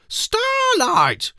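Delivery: very high, loud delighted squeal; girl delighted to see the trolley, squealing high; delighted cry. A narrator's reading. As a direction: greet an old friend with joy. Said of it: "said Ketchup Face delightedly".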